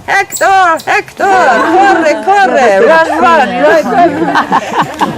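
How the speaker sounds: loud, high parrot squawk of words; screaming parrot. Retold or imitated. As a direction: imitate a parrot screaming out orders. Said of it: "suddenly the parrot started screaming".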